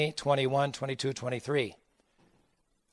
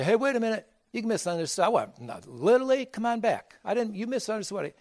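high mocking plea, pitched up; mock pleading; 8 semitones higher than usual. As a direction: ham up a pleading call to come back.

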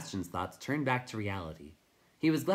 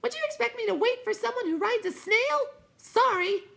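very high, loud fluttery voice; a flitting light that squeaks; squeaky wisp voice; 7 dB louder than usual. A narrator's reading.

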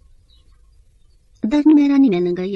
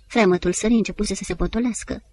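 plain reading voice

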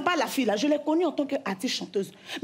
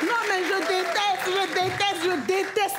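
slow, high exasperated whine; 4 semitones higher, slower than usual. Acted. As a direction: drag out the hate, high-pitched. Exaggerated repeated complaint.

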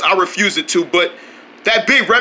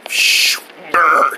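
ordinary delivery

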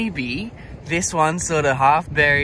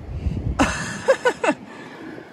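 high breathless giddy giggle; real, airy, high giggle; giddy laugh. Real, not acted. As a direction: laugh out of pure disbelief. Overwhelmed by the find.

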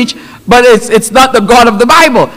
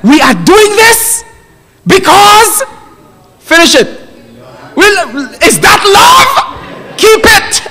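high, halting scolding between blows; hammed-up parent lecturing mid-spanking; pitched way up, broken into emphatic bursts; 9 semitones higher, slower than usual. Parent spanking while voicing a lesson.

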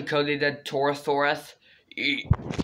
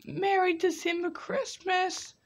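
put-on squeaky kid voice; 13 semitones higher, 4 dB quieter than usual. High dinosaur kid voice.

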